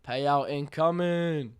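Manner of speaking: loud, drawn-out hype call; hyped announcing. Real, not acted. Streamer announcing a payout to chat, hyped announcing. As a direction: stretch it out loudly like an announcement.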